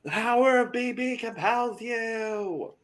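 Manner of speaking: high, drawn-out exorcism chant; playful high-pitched incantation; mock exorcist. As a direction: intone it slowly like a hammed-up exorcism.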